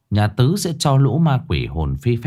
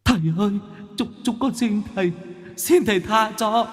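high, softer whimpering plea from little ghosts; pleading ghosts; 11 semitones higher, 5 dB quieter than usual. Acted. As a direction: beg for mercy in a thin high ghost voice. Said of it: "gào thét". (tiểu quỷ van xin thầy, giọng cao và nhỏ)

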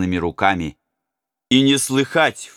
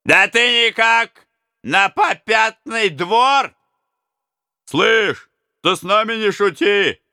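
high, slow menacing taunts; threatening robbers; 13 semitones higher, 4 dB louder, slower than usual. Acted. Robbers threaten the hesitating prince, high and menacing. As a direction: warn him off like a rough brigand.

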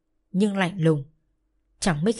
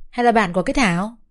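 pouting tease; 2 semitones higher, 4 dB louder than usual. Acted. Girl mocking Thảo in front of the new boy, pouting. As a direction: sneer at Thảo with a pout. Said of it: "Cái Hiền trù cái miệng ra".

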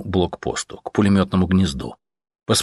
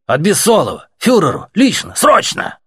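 louder and higher, each word barked out; urgent shouts; 11 semitones higher, 5 dB louder than usual. Acted. Soldier shouting urgent orders to the officer. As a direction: hammer out the urgency.

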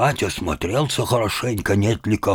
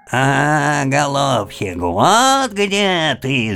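higher, slow bleat; bleating ram's head; 7 semitones higher, 4 dB louder, much slower than usual. Acted. Ram's head in the tree mocking grandfather, slow bleat. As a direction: bleat the words like a ram. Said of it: "заблеяла баранья голова".

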